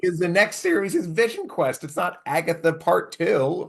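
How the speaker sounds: high-pitched baffled protest; acting out high, flustered disbelief; retold exasperation. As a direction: protest in mock panic. Retelling his panicked objection to cliffhanger.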